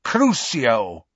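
lightly voiced cold, cruel sorcerer; cold incantation. A narrator's reading. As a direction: cast the curse in a cold villain voice. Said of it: "heard the high, cold voice say".

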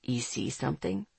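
very soft, low male voice; secretive, low-pitched murmur; hushed question. A narrator's reading. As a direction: ask low and under the breath. Hushed reply in whispered exchange.

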